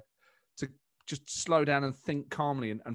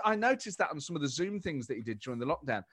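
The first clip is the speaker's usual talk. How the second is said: usual speaking voice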